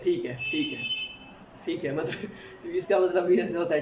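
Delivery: ordinary delivery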